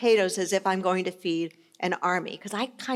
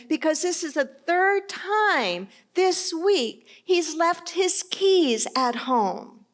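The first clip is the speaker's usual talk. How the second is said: high-pitched, play-acted exasperation; exasperated mock yelling; 8 semitones higher, slower than usual. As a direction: complain like a fed-up dog owner. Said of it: "starts yelling at the dog".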